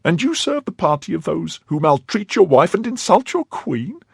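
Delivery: high, indignant wife's voice; scolding her husband for betraying the queen; reproachful wife. Acted.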